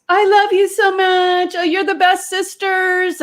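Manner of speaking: high, syrupy mock affection; gushing sisters. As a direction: imitate over-the-top loving messages. Mimics sisters' gushing messages.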